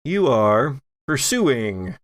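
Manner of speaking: normal talking voice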